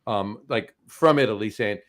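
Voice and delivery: ordinary talk, nothing marked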